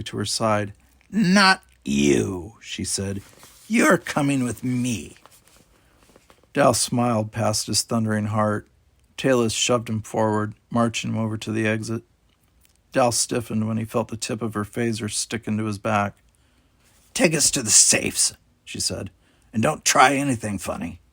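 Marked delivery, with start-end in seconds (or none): 1.1-5.2 louder, slow, threatening voice; menacing captain. A narrator's reading. 17.1-21.0 threatening order; commanding villain. A narrator's reading.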